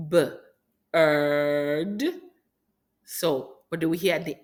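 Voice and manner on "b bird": B- then bird, split apart; segmenting sounds. Shown as a demonstration. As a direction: tap out bird slowly. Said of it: "Let's do bird slowly".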